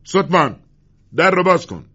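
loud, high-pitched shouted order; loud call. A narrator's reading. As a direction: call out to be heard through a door. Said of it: "گوان با صدایی بلند گفت". (فریاد بلند و آمرانه)

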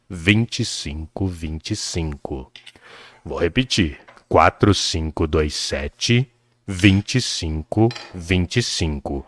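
low, slow radio announcer; deep late night radio DJ read. Shown as a demonstration. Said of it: "Eu vou falar com voz de locutor de rádio A M".

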